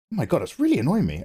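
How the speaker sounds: ordinary talk, nothing marked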